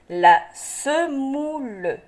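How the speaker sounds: "La semoule" slow and stretched, la - se - mou - le; syllable by syllable. Shown as a demonstration. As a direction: sound out "La semoule" piece by piece. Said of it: "des syllabes qui se donnent la main".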